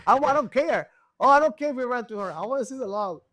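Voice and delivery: high, loud imitation of an eager tuner; insistent demand. Tuner demanding the data log after the record pass, high insistent voice. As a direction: mimic him fussing over the log.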